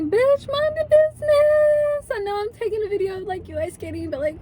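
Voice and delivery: mock diva telling off a stranger; sassy retort. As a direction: snap back at her, way up high. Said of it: "I'm like".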